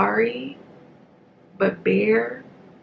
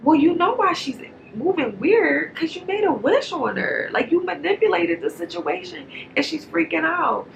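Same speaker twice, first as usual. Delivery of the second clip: retorting to Bear; 7 semitones higher than usual. Retold or imitated. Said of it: "And I'm just like".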